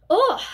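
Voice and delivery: loud high disgusted groan; disgusted ugh. Real, not acted. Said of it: "disgusted sound".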